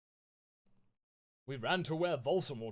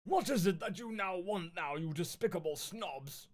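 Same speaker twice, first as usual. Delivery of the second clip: angry Dumbledore; 5 semitones higher than usual. Acted. Dumbledore scolding the goth girls, mock angry. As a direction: sneer at the snobs. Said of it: "he asked angrily".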